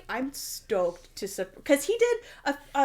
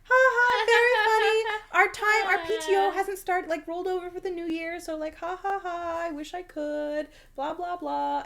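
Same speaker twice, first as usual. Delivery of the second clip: sarcastic brush-off; 7 semitones higher than usual. Retold or imitated. Brushing off boyfriend's suitcase joke, sarcastic and high. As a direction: laugh it off sarcastically, then let it fade. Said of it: "And I was like".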